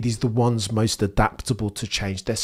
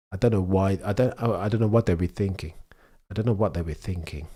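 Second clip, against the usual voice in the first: ordinary talk, nothing marked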